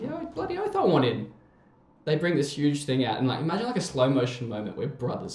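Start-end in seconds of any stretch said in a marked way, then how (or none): 0.0-1.3 high, far up the register, eager; eager yes. Retold or imitated. Accepting fried chicken from new friends, eager and high. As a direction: jump at the offer. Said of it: "And I'm like".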